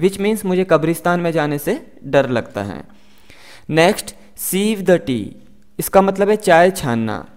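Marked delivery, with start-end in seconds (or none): none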